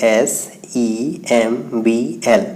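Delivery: slow letter-by-letter spelling. Shown as a demonstration. Teacher listing the letters left in the word, slowly spelled out.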